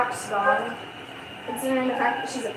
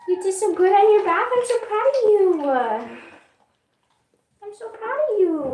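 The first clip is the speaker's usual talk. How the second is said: much higher, louder praise; high praise to the dog; 7 semitones higher, 3 dB louder than usual. Real, not acted. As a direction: cheer her on like a proud parent. Praising dog after bath.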